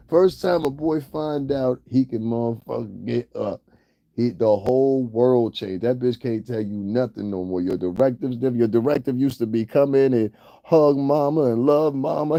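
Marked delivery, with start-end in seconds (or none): none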